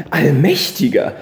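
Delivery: shocked cry. Acted. Startled mother to the stranger, loud cry. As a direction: gasp it out seeing the paper. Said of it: "rief sie".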